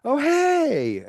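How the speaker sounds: mock high, drawn-out lovey greeting; sweet-talk phone voice. Guy greeting his crush on the phone, put-on high voice. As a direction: jump way up, imitating a smitten guy. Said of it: "that's not your voice".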